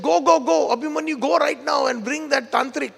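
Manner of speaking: high-pitched, hurried sending off; urgent command. Retold or imitated. Mother-in-law sends her son for the tantric, urgently.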